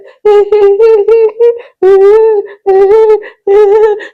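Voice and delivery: high, loud mocking laugh. Coach mocking a wife with a shrill-free high cackle. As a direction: cackle with scorn before the wife's rant.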